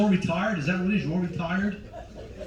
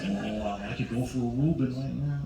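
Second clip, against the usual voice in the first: lower, quieter imagined craving; sandwich-craving audience member; 4 semitones lower, 5 dB quieter than usual. Acted. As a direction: voice a man's thought, low and longing. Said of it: "look at the faces".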